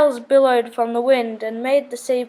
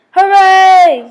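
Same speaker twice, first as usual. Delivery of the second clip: big loud high cheer; yelled cheer; 7 semitones higher, 10 dB louder than usual. A narrator's reading. Creatures round the campfire cheering the story's end, loud cheer. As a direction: cheer it out at full volume. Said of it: "yelled all the creatures".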